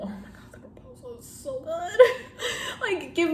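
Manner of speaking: gushing squeal. Real, not acted. Rom-com lover gushing over a favorite film, squealing. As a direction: squeal it small and high, swooning.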